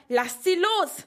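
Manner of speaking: high-pitched, forceful narrated shout; urgent command, pitch leaping up; loud shout. A narrator's reading. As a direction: shout to stop them. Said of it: "So laut er konnte".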